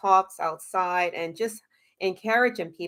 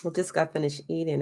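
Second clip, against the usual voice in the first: usual speaking voice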